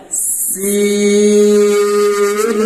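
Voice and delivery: সিন held long, sung and loud; stretched sin. Shown as a demonstration. Teacher shows four-count stretch on সিন. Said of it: "চার ভাগে টান হবে".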